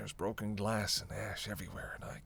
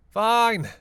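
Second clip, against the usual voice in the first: much louder, higher outburst; exasperated shout; 11 semitones higher, 14 dB louder than usual. Acted. Giving in to partner's plan, exasperated shout. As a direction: burst out in angry surrender.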